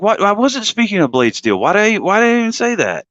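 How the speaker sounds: high, bewildered disbelief; baffled at himself. Retold or imitated. Watching his old video in baffled disbelief. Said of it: "I'm watching, I'm like".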